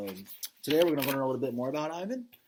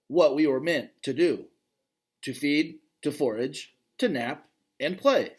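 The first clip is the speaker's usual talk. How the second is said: clear, fuller read-aloud voice, brighter than his asides; story narration; 5 semitones higher, 8 dB louder than usual. Acted. Dad reading a gorilla novel to his kids, storytelling narration.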